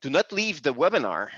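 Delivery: ordinary delivery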